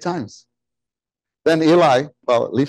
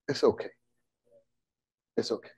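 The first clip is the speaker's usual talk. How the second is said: very soft, soothing murmur; hushed and calming, fading away; gentle reassurance; 3 semitones lower, 11 dB quieter, much faster than usual. Acted. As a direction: comfort quietly, as an inner voice. Voices a calming inner feeling.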